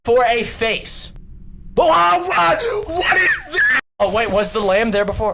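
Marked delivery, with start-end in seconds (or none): 1.8-3.8 startled. Real, not acted. Startled by something in game.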